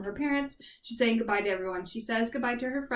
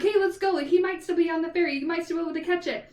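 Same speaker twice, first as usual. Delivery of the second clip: high, louder urging, speeding up at the end; urgent excitement; 7 semitones higher, 5 dB louder, faster than usual. Retold or imitated. Lulu urges Meredith toward the ferry, high and urgent. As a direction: hurry her out the door.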